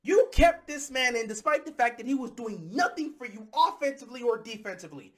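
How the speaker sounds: ordinary delivery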